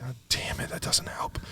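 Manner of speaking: whispered curse under the breath; frustrated whisper. Real, not acted. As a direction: hush it out in mild annoyance. Frustrated over written or wrote.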